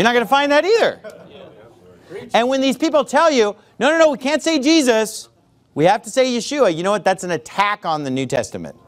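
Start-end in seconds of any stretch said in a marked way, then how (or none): none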